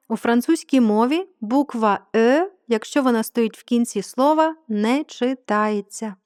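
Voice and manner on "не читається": "не читається" drawn out slowly, word by word; emphatic slow rule. Shown as a demonstration. Teacher tells learners the final Е is silent, slow emphatic "не читається".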